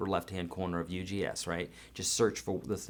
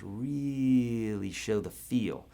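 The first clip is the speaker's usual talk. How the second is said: very slow, drawn out phrase; lingering, sultry delivery drawn way out; much slower than usual. Shown as a demonstration. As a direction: stretch every word to show the feel. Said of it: "real slow and real sultry".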